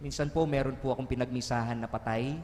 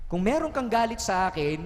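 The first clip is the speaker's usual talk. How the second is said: higher-pitched, imitated angry prayer; angry reproach; 7 semitones higher, 4 dB louder than usual. Praying parent reproaches God over a sick child, angry. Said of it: "Galit na galit, sinusumbatan ng Diyos".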